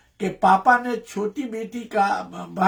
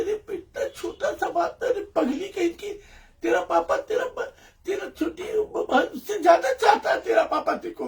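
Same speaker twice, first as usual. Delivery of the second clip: comforting mother; 8 semitones higher, 3 dB quieter than usual. Retold or imitated. Mother comforting crying daughter.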